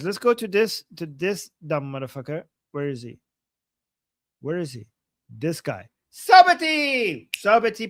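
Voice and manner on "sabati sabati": high, loud, drawn-out announcement; excited name shout. Real, not acted. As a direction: belt out the name, stretching it. Excitedly announcing the man found. (long triumphant call of a name)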